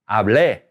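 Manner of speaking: hablé with the final É punched and drawn out; stress on final E. Shown as a demonstration. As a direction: hit the last syllable of hablé hard. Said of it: "stress that E when you say it".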